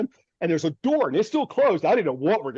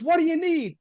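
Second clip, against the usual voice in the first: voicing the farm lady; 11 semitones higher than usual. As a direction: put on the startled farm lady's high voice. Farm lady voiced in story.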